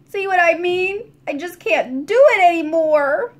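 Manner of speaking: landlady sniffling after crying; tearful despair. A narrator's reading. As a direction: give up in distress. Landlady blows nose, despairs.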